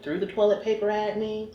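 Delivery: ordinary delivery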